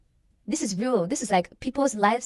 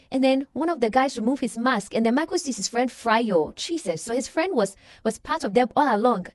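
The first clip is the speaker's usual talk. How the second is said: usual speaking voice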